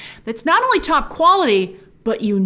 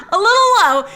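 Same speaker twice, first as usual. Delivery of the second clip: laughing; 11 semitones higher, 4 dB louder than usual. Real, not acted. Laughs at own low expectations.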